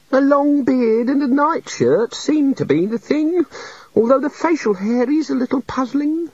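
high meek little god. A narrator's reading. The little god confesses to the wizards, meek and high. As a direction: play a shy, sorry deity.

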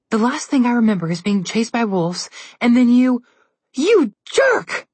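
rising to a furious high yell; angry outburst. A narrator's reading. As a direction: blame him, pitch climbing. Sister angry at brother.

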